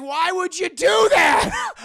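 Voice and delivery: loud, high, drawn-out outraged yell; outraged shout. Retold or imitated. Father scolds son for bringing diary, outraged shout. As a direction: yell it in disbelief, stretching it.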